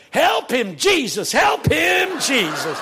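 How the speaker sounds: loud, high congregant's holler; yelling. Retold or imitated. Lady in the back to a struggling preacher, yelling. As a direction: cry out for help from the back pew. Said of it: "some lady in the back yelled".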